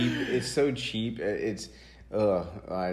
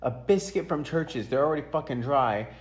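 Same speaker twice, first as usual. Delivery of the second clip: mom scolding; 5 semitones higher than usual. Retold or imitated. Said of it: "She says".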